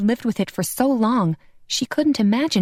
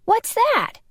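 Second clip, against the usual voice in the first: mouth full. A narrator's reading. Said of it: "Tino asked with his mouth full".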